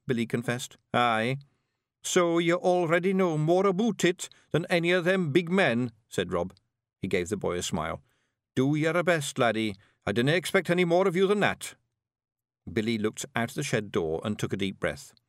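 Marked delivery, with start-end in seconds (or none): 0.9-11.7 high, brisk Scottish little warrior; higher Scots brawler, impatient; Scottish pictsie leader. Acted. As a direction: bark it out like a tiny Scots chieftain. Rob encourages boy.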